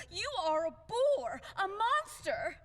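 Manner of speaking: high, loud, slow outraged insult; angry insult. Acted. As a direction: spit out the insults. Insulting him in a rage.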